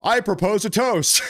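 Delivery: high, playful toast-giver; lightly hammed-up call for a toast; mock toast. Mock toast at the imagined meeting.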